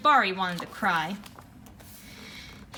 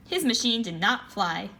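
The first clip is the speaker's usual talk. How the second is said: plain reading voice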